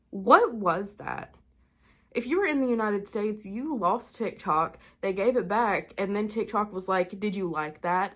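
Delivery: usual speaking voice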